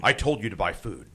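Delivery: low hushed doomsday voice; Glenn Beck impression. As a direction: intone it like a prophet of doom. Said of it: "really serious mode with Glenn".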